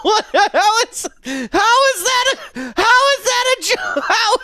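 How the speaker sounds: high-pitched, helpless laughing disbelief; real laughter breaking up the words; incredulous laughing. Real, not acted. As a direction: crack up while trying to ask the question. Cracking up at a failed joke.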